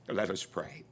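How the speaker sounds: ordinary delivery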